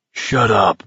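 hoarse, restrained order to be silent; husky-voiced demand. A narrator's reading. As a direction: say it hoarsely, without shouting. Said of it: "Abraham said hoarsely".

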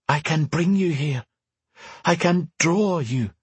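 an urgent voice from afar, kept gentle; mysterious woman voice. Acted. Telepathic woman speaking.

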